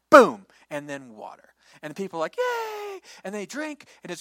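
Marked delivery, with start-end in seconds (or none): 0.1-0.4 loud explosive boom; big boom. Acted. Water bursts from rock. 2.4-3.0 very high, squealing cheer. Acted. People cheer for water.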